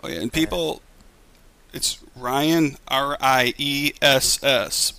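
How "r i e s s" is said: slow, emphatic letter-by-letter spelling; drawn-out, pointed spelling of a name. Real, not acted. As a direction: spell each letter out slowly and firmly. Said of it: "Please spell the kids' names right".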